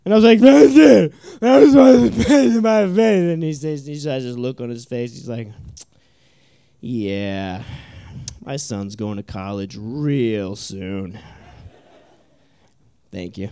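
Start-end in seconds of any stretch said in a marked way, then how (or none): 0.4-3.3 put-on loud, higher kid's reply; loud answer. Son answering his father, loud and higher. Kid answers dad loudly. 6.8-11.2 low, soft, slow dry sarcasm; deadpan sarcasm. Retold or imitated. Dad quietly mocking his son's smarts, low and dry.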